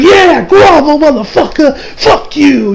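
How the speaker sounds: high, loud mocking jeer; jeering crowd. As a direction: taunt the beaten villain from the crowd. Crowd jeers at the villain.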